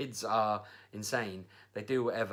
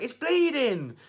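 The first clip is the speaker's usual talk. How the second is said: high, loud mock wail; hysterical child; 14 semitones higher, 8 dB louder, slower than usual. Child claims his ear was cut, mock hysterical yell. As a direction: imitate a kid yelling in panic. Imitates son freaking out.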